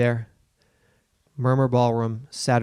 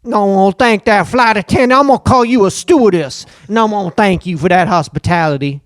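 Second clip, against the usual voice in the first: hammed-up New Orleans accent, higher and louder; 4 semitones higher, 6 dB louder than usual. Host plays himself thanking a flight attendant in a hammed-up New Orleans accent. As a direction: lay on a thick New Orleans accent, grateful. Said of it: "I'm gonna talk like that".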